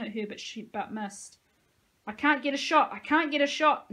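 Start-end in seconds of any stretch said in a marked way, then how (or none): 2.1-3.9 panicked shout, much higher and louder. Acted. Nico yells while trying to aim the gun. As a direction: yell it in a panic, hands shaking. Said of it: "Nico shouted".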